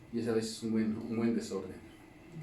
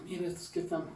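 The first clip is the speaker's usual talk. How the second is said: higher, lightly mocking aside; burla imitada; 6 semitones higher than usual. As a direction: imitate a scoffer pointing people out. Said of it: "Que se burlan, dicen". (burlón imitado, en tono más agudo)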